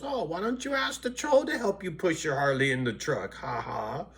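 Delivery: high, sneering imitation of a viewer; mocking commenter. Quoting a viewer who teases him, in a high mocking voice.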